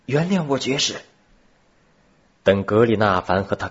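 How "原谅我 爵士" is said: put-on high-pitched child calling out; a boy's raised, high-pitched plea; child's voice. A narrator's reading. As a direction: call out high, like a boy. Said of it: "一个孩子的声音从黑暗中传了过来".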